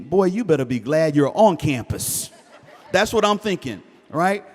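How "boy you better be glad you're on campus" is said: stern dad thought. Retold or imitated.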